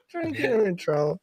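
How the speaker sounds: laughing. Real, not acted. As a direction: repeat it through a laugh. Laughs while repeating.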